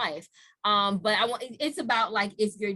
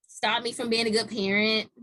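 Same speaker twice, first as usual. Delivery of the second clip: ordinary talk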